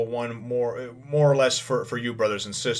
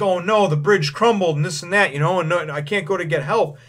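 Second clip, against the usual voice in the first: higher, louder mock dismay; retold cry of alarm; 6 semitones higher, 4 dB louder than usual. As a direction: act out someone finding the escape cut off. Voices Vivi finding bridge gone.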